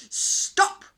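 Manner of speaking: sharp loud stop; shouted command. A narrator's reading. Knight silences the screaming prisoners, loud command. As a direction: cut through the noise with one loud word. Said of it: "shouted Sir Edward".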